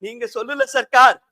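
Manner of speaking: normal talking voice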